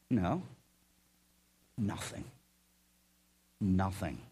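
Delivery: low, hushed, hesitant reply; meek answer. Acted. Listener answering God's question, meek and hushed. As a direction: answer very softly and low, pausing between words.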